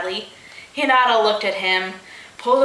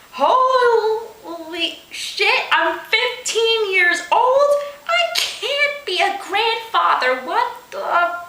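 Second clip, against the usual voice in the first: much higher, slow panicked cries; hysterical; 11 semitones higher, slower than usual. A narrator's reading. Hinata panicking about becoming a grandfather, hysterical. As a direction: lose it completely. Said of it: "he said hysterically".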